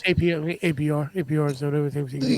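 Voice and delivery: low, fast hard sell; car salesman voice. Acted. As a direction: rattle off the deal like a dealer. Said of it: "car salesman voice".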